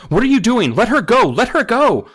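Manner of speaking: loud, high, urgent yelling; frantic demand. Acted. Mother tugging the narrator's arm, frantic yell.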